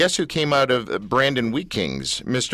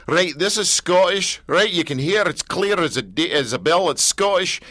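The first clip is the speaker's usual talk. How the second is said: higher-pitched comic Scottish accent, imitated; 5 semitones higher than usual. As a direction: demonstrate Scottish with crisp pride. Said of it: "he's there talking Scottish".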